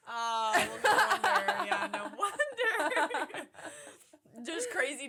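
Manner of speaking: high, loud laughing through the words; can't stop laughing. Real, not acted. Laughing at the dog.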